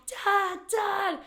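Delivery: high, loud panicked calls; screaming for dad. Retold or imitated. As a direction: yell for your dad across the water. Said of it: "I just started screaming so loud".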